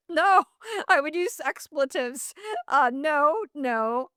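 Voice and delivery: a high, laughing no. Real, not acted. Joking about swearing on the podcast, a real laughing no. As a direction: laugh it off, then say no.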